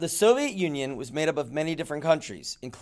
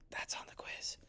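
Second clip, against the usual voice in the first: a conspiratorial whispered aside; 21 dB quieter than usual. Real, not acted. Teacher whispering a quiz hint to her class. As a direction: whisper it like a secret tip for students.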